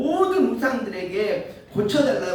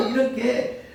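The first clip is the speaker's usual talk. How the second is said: normal talking voice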